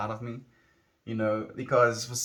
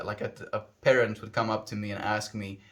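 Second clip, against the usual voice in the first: ordinary talk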